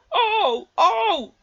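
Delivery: very high, loud cries; screaming trucks. A narrator's reading. Trucks jerked forward, shrieking high and loud. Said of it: "they screamed".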